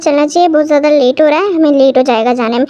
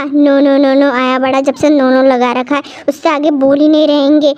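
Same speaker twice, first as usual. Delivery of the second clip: ordinary talk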